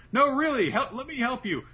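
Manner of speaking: high, loud pleading; eager boy begging to help; insistent plea. Retold or imitated. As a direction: press him to accept help, voice jumping up. Shinobu insisting in retold scene.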